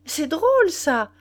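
high, loud exclamation; scornful amusement. A narrator's reading. Nana reacting to Mignon's news, scornful amusement.

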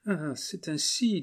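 high, slow, contemptuous; scornful. Acted. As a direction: sneer it coldly. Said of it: "en fronçant le sourcil". (ton méprisant et lent)

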